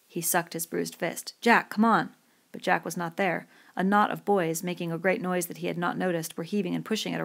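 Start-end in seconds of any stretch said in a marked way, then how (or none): 1.4-2.1 loud, higher-pitched call. A narrator's reading. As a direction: call a friend over urgently. Calling an absent companion.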